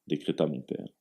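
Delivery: plain reading voice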